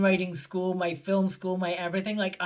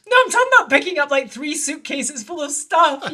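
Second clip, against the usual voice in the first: high, loud protest; mock exasperation; 6 semitones higher, 8 dB louder than usual. Her explaining she only has three suitcases, high and exasperated. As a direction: protest loudly at the absurdity, amused. Exasperated amusement at his overblown offer.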